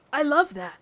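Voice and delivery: ordinary talk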